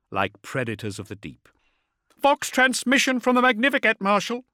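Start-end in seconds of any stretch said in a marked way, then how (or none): 2.2-4.4 urgent call. A narrator's reading. Bondsman calls out report.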